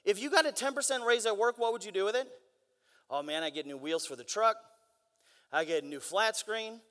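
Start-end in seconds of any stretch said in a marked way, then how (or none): 3.1-6.8 lightly voiced low, easygoing coworker; coworker answering. Coworker dreaming up what to buy with a raise, low casual answer.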